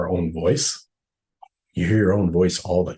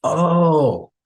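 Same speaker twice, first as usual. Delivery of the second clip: mock realization; 9 semitones higher, 5 dB louder than usual. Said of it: "the men are more like".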